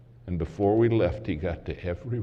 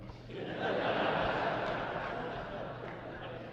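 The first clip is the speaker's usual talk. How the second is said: a quiet airy chuckle; gentle whispered laugh; soft breathy laugh; 11 semitones higher, 8 dB quieter than usual. Real, not acted. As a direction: laugh softly under the breath. Preacher laughs before story.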